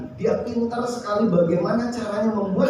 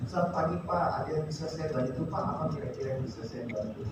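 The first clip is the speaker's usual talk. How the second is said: polite soft greeting; 3 semitones lower, 10 dB quieter, much faster than usual. Retold or imitated. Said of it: "dia bertanya".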